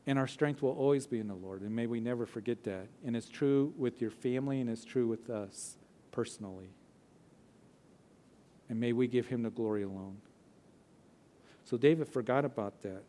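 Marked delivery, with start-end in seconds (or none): none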